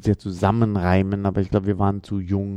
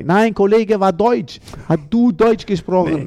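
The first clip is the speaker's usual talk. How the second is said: much higher, louder broken foreigner-talk German; mocking the club boss in exaggerated simple German; condescending boss; 10 semitones higher, 4 dB louder than usual. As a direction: talk down to him in pidgin German, pitched way up. Boss voiced in retold story.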